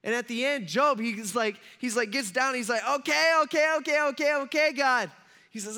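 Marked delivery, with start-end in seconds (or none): 2.9-5.1 loud, high-pitched repeated giving in; overwhelmed surrender. Acted. Job gives in to God's questioning, overwhelmed and loud. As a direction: cry it out, begging the questions to stop.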